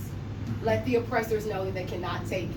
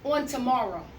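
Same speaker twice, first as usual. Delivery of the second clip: measured, pausing poem reading; spoken word poem; 7 semitones higher, 4 dB louder than usual. Acted. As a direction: let each phrase land, with slow pauses. Second poem performance.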